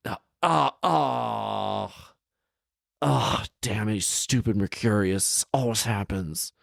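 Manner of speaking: a hint of a whiny trapped genie; frustrated genie. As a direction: groan it out like a genie caught again. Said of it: "he's like".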